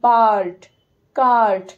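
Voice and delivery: teacher stretching the vowel; long a demo. Shown as a demonstration. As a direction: hold the a in বার্ড and কার্ট. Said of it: "অনেকক্ষণ ধরে উচ্চারণ হচ্ছে".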